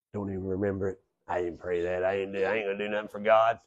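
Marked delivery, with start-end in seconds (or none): 1.3-3.6 muttered much softer, lower, offhand; a quiet, dismissive denial once rescued; 1 sly mock denial. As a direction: shrug it off under the breath. Acted denial after rescue.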